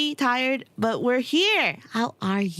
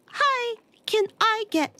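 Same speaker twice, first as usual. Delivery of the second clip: high, bright-eyed request; sweet polite ask; 13 semitones higher than usual. Retold or imitated. Asking the flag volunteer, in a sweet high voice. Quoting herself asking for flags.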